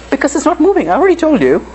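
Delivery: high put-on student voice; mock student answer. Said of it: "And you tell me".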